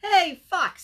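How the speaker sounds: high, loud bird calling to a friend. Acted. Bird calls to Fox on the hike, calling out. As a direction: pitch it up and call across.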